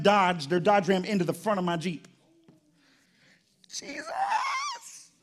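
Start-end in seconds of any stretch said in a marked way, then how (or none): 3.7-5.1 high yelped cry of shock; startled, laughing shout; mock shocked shout. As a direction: shriek it like the crash just hit. Reacting to the crash.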